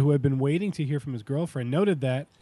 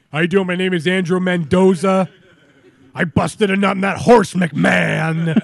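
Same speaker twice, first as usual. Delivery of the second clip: loud, hammed-up Cuban wrestler accent; Razor Ramon; 3 semitones higher, 9 dB louder than usual. Host playing Andrew Mendoza for co-hosts, loud wrestler impression. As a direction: boom it out like a wrestler cutting a promo. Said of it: "Wait, Razor Ramon?".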